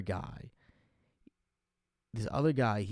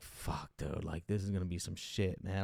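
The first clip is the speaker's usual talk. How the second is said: low, soft inner groan of dread; dreading mutter; 6 semitones lower, 7 dB quieter than usual. Retold or imitated. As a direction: mutter it lower and softer, bracing for trouble. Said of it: "And I'm like".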